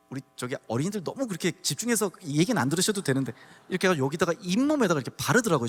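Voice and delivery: normal talking voice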